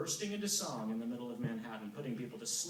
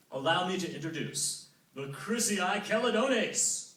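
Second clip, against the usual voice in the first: grand god voice; 5 semitones higher, 6 dB louder than usual. Acted. Apollo grandly introduces singers.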